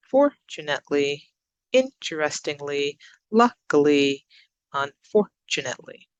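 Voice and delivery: each word measured out, stressed syllable punched; careful pronunciation list with marked stress; syllable stress drill. Shown as a demonstration. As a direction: model fundamentally to unfortunately for learners, one by one. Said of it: "So practice saying them".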